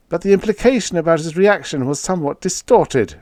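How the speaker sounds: a lightly voiced Fitzhugh protesting. A narrator's reading. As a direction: push back nervously. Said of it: "Fitzhugh protested".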